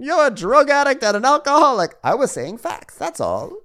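high, loud mock Karen; shrill accusing Karen parody. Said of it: "clip of her saying".

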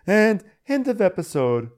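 high-pitched closing line; teasing sign-off. Pretend. Second, teasing try at ending.

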